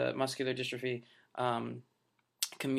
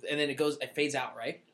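ordinary talk

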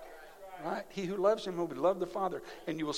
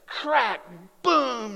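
crack boom; 6 semitones higher, 6 dB louder than usual. Retold or imitated. Imitating lightning and thunder.

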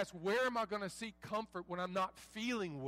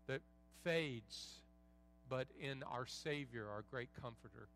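softly spoken, low pastoral prayer; 5 semitones lower, 5 dB quieter than usual. Real, not acted. Pastor praying for struggling believers in the room, hushed prayer.

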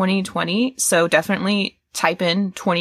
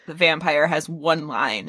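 normal talking voice

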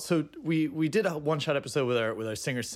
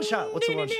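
very high squeaky question; faster than usual. Acted. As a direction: pitch it way up like a cartoon.

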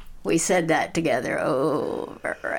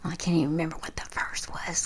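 whispered aside; 7 dB quieter, faster than usual. Real, not acted. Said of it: "whispered aside".

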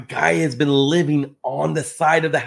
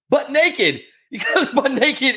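laughing; 10 semitones higher, slower than usual. Real, not acted. Laughing while retelling naked seller.

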